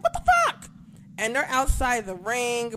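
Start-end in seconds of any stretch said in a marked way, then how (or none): 0.0-0.7 high, loud squeal of disbelief; shocked disbelief. Retold or imitated. Watching a commercial cut during the fight, a shocked high squeal. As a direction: shriek it like you can't believe the TV. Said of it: "I'm like".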